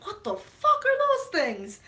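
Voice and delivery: loud, high, drawn-out disbelief; slow, acted "what on earth" cry; shocked audience. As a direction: re-enact a whole theater's alarmed outburst. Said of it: "everyone in the theater was like".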